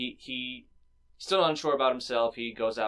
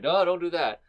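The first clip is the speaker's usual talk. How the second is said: urgent protest; 7 semitones higher, 7 dB louder than usual. Retold or imitated. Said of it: "and was like".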